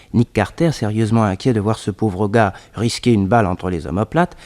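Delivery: usual speaking voice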